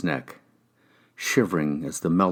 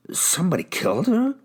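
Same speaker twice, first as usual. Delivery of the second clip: high, startled blurt of surprise; 12 semitones higher than usual. A narrator's reading. Ex-con blurts to detectives in sudden shock. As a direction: caught off guard, it just slips out high. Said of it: "I blurted out".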